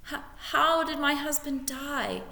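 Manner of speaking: high, catching voice; tearful whisper. A narrator's reading. Widow asking the lord how her husband died, tearful. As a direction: barely get the words out. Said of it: "whispered Missus Beamish".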